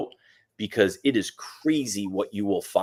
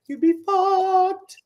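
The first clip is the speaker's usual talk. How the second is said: mock warning; 4 dB louder, much slower than usual. Mock tweet warning, drawn out.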